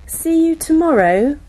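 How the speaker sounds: ordinary delivery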